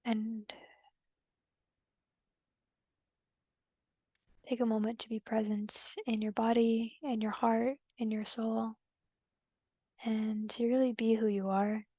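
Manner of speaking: calm, unhurried meditation guide with long pauses; guided meditation. Acted. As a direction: gently guide them inward, leaving long silences between phrases.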